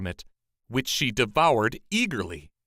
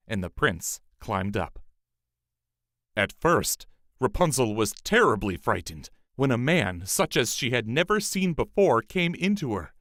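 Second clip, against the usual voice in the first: usual speaking voice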